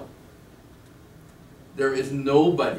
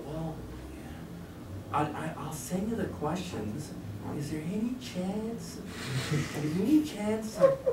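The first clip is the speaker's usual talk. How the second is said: mimicking a girl; 7 semitones higher than usual. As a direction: coax like a sweet young woman asking a favour. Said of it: "we really have to sound like one of these girls now. We have to lower that tone way down".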